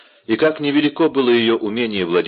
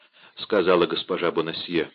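ordinary delivery, nothing marked

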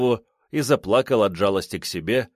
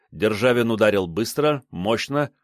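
plain reading voice

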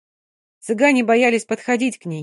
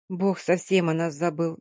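ordinary delivery, nothing marked

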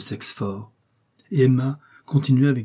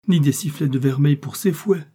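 higher, louder indignant reproach; scornful tirade; 5 semitones higher, 5 dB louder than usual. A narrator's reading. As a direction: hurl scorn at his riches.

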